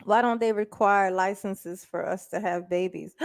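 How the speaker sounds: ordinary delivery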